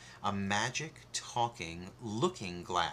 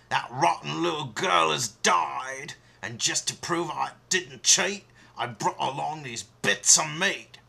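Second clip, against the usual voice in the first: louder eager huntsman call; 5 semitones higher, 7 dB louder than usual. Acted. Huntsman reporting to the queen, calling out. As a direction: hail the queen proudly. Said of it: "he cried".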